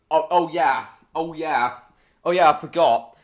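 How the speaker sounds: loud, bright "oh yeah" as it comes back to him; sudden realization. Real, not acted. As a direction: burst out as the memory hits. Suddenly remembers while watching clip.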